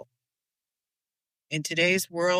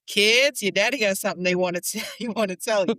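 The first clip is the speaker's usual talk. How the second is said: put-on loud, higher call to the kids; 4 semitones higher, 5 dB louder, faster than usual. Wife calls the kids out to hear their father: loud, higher calling voice.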